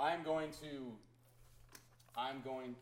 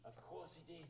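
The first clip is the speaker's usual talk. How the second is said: ordinary talk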